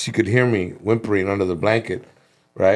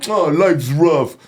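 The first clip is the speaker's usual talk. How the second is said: slow, higher-pitched complaining put-on voice; mock self-pity; 6 semitones higher, 3 dB louder, much slower than usual. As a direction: wail it like a hammed-up quitter. Mimics someone giving up and complaining.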